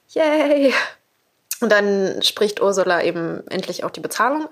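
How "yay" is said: high squeaky mock yay; mock cheer. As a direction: cheer it sarcastically. Mocking cheer.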